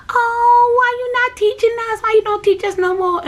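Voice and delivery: put-on high, loud pleading kids' voice; imitating little kids. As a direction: whine it like small children asking why. Children begging their teacher.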